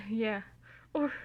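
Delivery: ordinary delivery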